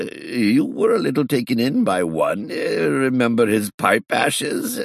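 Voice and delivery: put-on high, chuckling sheepish old gentleman; sheepish old man. A narrator's reading. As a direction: confess the pranks a bit bashfully. Old man recounts his pranks.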